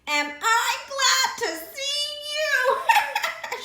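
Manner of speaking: high, slow, cackling witchy voice; cackling creature. A narrator's reading. Creature cackling at the piglet she caught. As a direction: gloat with a cackle.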